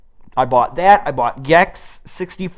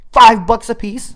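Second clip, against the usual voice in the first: excited; 8 semitones higher, 7 dB louder than usual. Real, not acted. Thrilled at cheap game prices.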